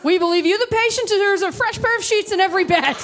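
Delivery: high, loud hammed-up announcement; mock proud proclamation. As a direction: proclaim it like an absurd hospital slogan. Hospital slogan mocked in acted scene.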